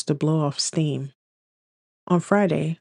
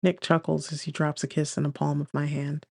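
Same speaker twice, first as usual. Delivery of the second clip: ordinary delivery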